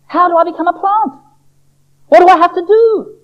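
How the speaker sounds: high, eager little-seed voice; an impatient seed begging to know; Sammy the seed. Acted. Sammy begs the doctor.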